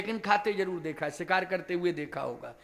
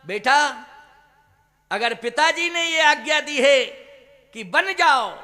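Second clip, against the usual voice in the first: loud, raised storyteller's appeal; acted motherly insistence; 5 semitones higher, 9 dB louder than usual. Mother's plea voiced in retelling. (माँ की ऊँची आवाज़ में समझाइश)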